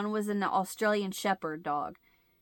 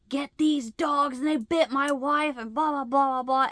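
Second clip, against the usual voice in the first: loud, higher-pitched mock husband rant; imitated angry yelling; 6 semitones higher, 5 dB louder than usual. Husband confronting dog owner over bitten wife, imitated angry yell. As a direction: yell it like an irate husband, running into blah-blah. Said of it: "I have never heard my husband yell like this".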